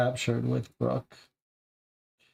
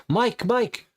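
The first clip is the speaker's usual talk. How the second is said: high, loud calling of a name; screaming for a friend under attack; frantic shout; 10 semitones higher, 4 dB louder than usual. Acted. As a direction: shout for him in terror. Crying out during an attack.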